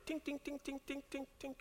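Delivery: tiny high tink tink of blades on steel; soft tinking knives. Retold or imitated. Pastor mimics knives bouncing off a tank, soft high tinks. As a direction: tap it out light and high like knives off armor. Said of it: "Even a hundred of them".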